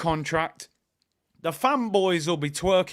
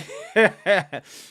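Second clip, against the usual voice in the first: a short loud burst of laughter at his own joke; real laugh; 5 dB louder than usual. Real, not acted. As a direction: laugh out loud, amused at yourself.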